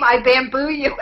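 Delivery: a loud mock yell of the threat; retold scream. Retelling the priests, a loud mock yell. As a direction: shout it like the priests did. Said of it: "so now they would scream".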